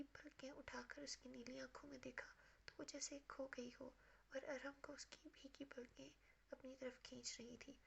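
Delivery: hushed, breathy storytelling read; soft story narration. A narrator's reading. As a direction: read it softly like a bedtime novel. Reads romance novel narration.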